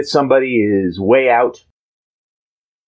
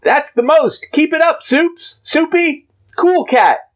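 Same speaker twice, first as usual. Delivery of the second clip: put-on excited teens; slower than usual. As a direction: cheer like a crazed fan. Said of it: "wigs saying".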